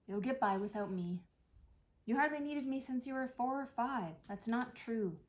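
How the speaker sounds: normal talking voice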